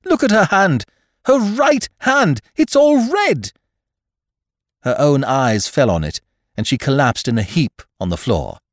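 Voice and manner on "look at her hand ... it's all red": loud, high, horrified shout; alarmed outburst, building; shocked cry. Acted. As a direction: cry out urgently, climbing in pitch. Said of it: "I cried".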